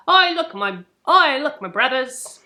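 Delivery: put-on Cockney, with a restart; mock Cockney. Shown as a demonstration. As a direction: hail your mates in hammed-up Cockney. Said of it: "I gotta stop doing the accent".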